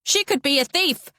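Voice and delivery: high alarmed shout. A narrator's reading. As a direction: raise the alarm to the whole bus. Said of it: "she shouts".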